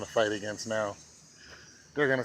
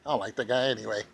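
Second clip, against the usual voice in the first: ordinary delivery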